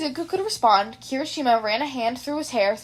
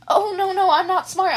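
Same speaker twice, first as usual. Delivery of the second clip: higher, louder, hurried fluster; flustered denial; 7 semitones higher, 5 dB louder than usual. Acted. Izuku flustered, denying he is smart to his friends, higher and louder. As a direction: wave off the compliment in a fluster.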